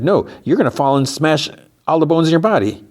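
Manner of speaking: high-pitched mock alarm; alarmed warning.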